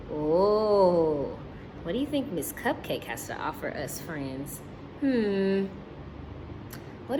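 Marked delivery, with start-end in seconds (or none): none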